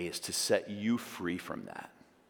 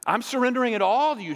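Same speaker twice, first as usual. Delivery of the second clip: higher and louder, building into fervent prayer; impassioned plea; 12 semitones higher, 8 dB louder, faster than usual. Pretend. Peter begging Jesus to wash all of him, fervent. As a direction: pour it out, begging to be washed clean. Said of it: "Peter replied".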